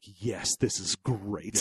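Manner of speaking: low, soft, slow delight; hushed approval. Retold or imitated. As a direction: savour it under the breath. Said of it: "Alan Cummings is like".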